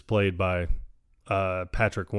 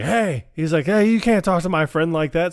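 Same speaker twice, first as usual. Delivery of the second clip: loud, higher, indignant yell of defense; angry shout; 8 semitones higher, 5 dB louder than usual. Retold or imitated. Drunk Peter yells at townsfolk insulting his friend, loud indignant shout. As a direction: raise your voice, stick up for a buddy.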